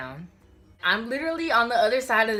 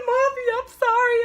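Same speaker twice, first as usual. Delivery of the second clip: much higher, louder, wailing through tears; crying apology; 7 dB louder than usual. Retold or imitated. Child pleading with her mom during a whooping, crying. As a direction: beg through sobs. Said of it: "I was like".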